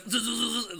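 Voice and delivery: high buzzing zap noise; taser buzz. Retold or imitated. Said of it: "And he goes".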